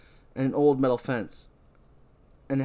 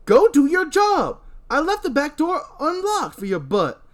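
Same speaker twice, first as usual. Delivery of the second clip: high, loud barking orders; bossy shouting character; 8 dB louder than usual. Acted. Boss yelling at Michael to go work. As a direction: shoo him off to do his job.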